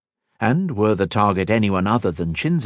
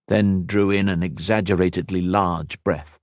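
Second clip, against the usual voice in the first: ordinary delivery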